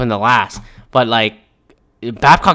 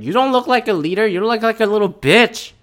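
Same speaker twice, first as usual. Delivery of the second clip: higher and faster, contemptuous telling-off; angry scolding; 8 semitones higher than usual. Retold or imitated. Streamer scolding the head coach, mock angry contempt. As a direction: snap at the coach in disgust. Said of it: "I was very angry when I saw this story".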